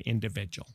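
individual in grandma's way, "in-duh-VIJ-ul"; grandma's pronunciation. Retold or imitated. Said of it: "as my grandma would say".

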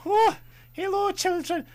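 high, loud put-on Scottish granny voice; awful Scottish accent. Imitating Mrs Doubtfire greeting children in an awful Scottish accent. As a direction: squeal it like a bad Mrs Doubtfire. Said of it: "I still wanna go".

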